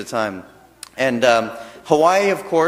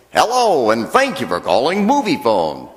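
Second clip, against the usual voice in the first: imitating the recorded movie line greeting; movie phone announcer voice; 4 dB louder than usual. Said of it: "now you know the voice".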